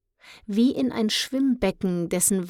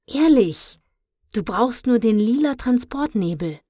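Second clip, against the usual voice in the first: loud, higher, earnest protest; insistent; 5 semitones higher, 7 dB louder than usual. A narrator's reading. Luna tries to convince disbelieving Tim, insistent. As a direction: insist that it is really true.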